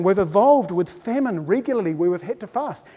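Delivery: ordinary talk, nothing marked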